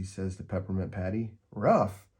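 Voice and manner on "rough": high, loud indignant "Rough?"; shout. A narrator's reading. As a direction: fire the word back in disbelief. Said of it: "Peppermint Patty shouts".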